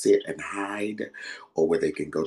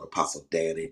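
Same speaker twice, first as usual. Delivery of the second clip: usual speaking voice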